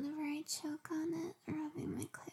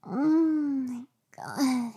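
slow moan; 3 semitones lower, 11 dB louder, much slower than usual. Acted. Moan during sex.

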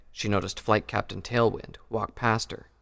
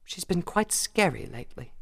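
higher, sheepish admission; 9 semitones higher than usual. A narrator's reading. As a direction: say it a little embarrassed. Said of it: "Rarity said sheepishly".

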